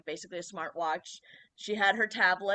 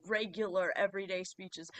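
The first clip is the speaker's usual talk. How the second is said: ordinary delivery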